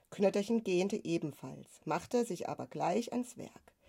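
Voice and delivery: plain reading voice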